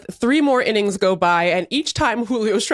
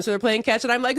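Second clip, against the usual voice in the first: ordinary talk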